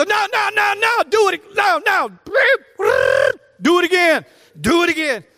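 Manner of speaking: high, urgent coach's yell; high-pitched yelled coaching commands. Retold or imitated. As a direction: imitate a coach hollering corrections across the field. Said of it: "he's yelling at 'em".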